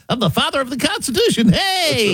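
high, squeaky helium voice; James Madison. Acted. Tiny founder introducing himself at a kids' party, helium squeak. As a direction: pipe it up like a tiny man. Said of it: "He sucks down helium".

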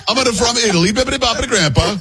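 high, laughing mock Italian gibberish. Host mocks the Italian woman's talk, high laughing mock accent. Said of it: "She came up".

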